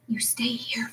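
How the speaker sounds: hushed. A narrator's reading. Flora sneaking, whispering to father.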